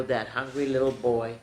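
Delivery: ordinary delivery, nothing marked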